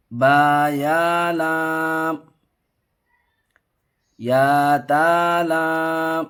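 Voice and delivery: drawn-out, sing-song reading of letter names; slow letter recitation. Shown as a demonstration. Teacher reading a Qaida lesson aloud, slow sing-song recitation. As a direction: chant each letter slowly for the pupil.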